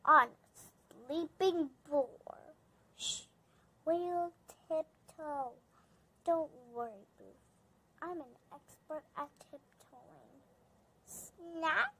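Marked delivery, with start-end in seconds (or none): 3.0-10.4 hushed whisper. Acted. Pine whispers to Boof past the sleeping boar. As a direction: hush so the boar stays asleep.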